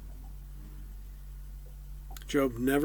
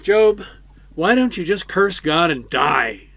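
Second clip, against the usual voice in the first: loud, higher angry outburst; furious wife; 5 semitones higher, 11 dB louder than usual. Retold or imitated. Wife lashing out at Job, loud furious outburst. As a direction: snap at him in exasperation. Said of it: "She is thoroughly pissed".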